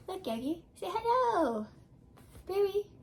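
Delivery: cat mom voice. Real, not acted. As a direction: coo at the cat. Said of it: "my cat mom voice".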